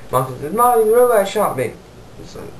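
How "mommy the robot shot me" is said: put-on high-pitched child tattling to mom; upset little kid. Boy runs to tell mom the robot shot him, upset little kid. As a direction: wail it like a hammed-up little kid.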